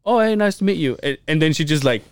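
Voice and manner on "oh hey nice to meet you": higher mock clerk greeting; imitating the clerk. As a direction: pitch up as the friendly clerk. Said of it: "And the person goes".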